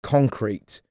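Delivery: ordinary delivery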